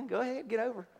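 grudging, sarcastic wave-through; softly sarcastic, begrudging the other driver. Retold or imitated. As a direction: let them in with mock generosity. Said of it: "I'm being sarcastic".

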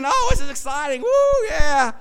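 high, loud excited squeal; screaming excitedly. Retold or imitated. Preacher imitating Melly cheering at the game, high squeal. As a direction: cheer like a thrilled fan. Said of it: "she was screaming".

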